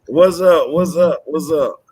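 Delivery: shouted hey, rising to laughter; loud greeting. Real, not acted. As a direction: call out across the room. Calling out greeting to friend.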